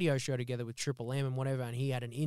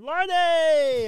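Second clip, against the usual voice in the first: loud, high shout of a fan's greeting; excited fan hollering a name; calling out; 14 semitones higher, 10 dB louder than usual. Retold or imitated. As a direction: yell his name across the street. Fans calling out on street.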